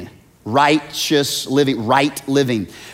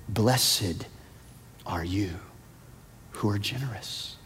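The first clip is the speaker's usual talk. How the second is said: soft blessing; 5 semitones lower, 14 dB quieter than usual. Acted.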